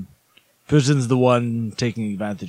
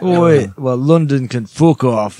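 loud, drawn-out British put-on; mock British accent; 5 dB louder, slower than usual. Joking back at the London remark, loud mock British.

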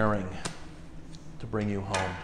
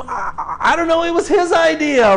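flustered parent; 10 semitones higher, 4 dB louder than usual. Retold or imitated. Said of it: "and you're just going".

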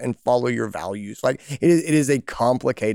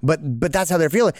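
usual speaking voice

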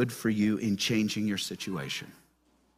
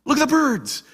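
higher, insistent repeat; emphatic call; 10 semitones higher than usual. Acted. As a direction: call their eyes to the birds. Quoting Jesus, repeated line.

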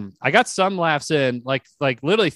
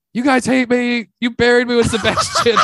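high, loud panicked complaint; mock meltdown, pitched up and loud; re-enacted freakout; 10 semitones higher, 5 dB louder than usual. Comic freaking out at a silent audience, high loud complaint. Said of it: "then I start freaking out".

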